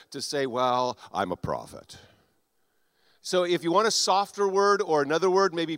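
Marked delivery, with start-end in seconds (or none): 0.5-2.0 low, reluctant mumbled confession; hesitant admission. Retold or imitated. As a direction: imitate someone reluctant to admit it, dropping very low. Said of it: "some people are very hesitant to".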